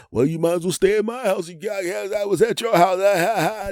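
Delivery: high, mocking taunt with fake laugh; high hammed-up gloating voice; gloating rival. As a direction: gloat like a smug neighbour.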